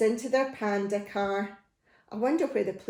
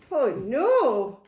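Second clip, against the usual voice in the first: alarmed; 2 semitones higher, 4 dB louder than usual. Acted. Reading to children, alarm.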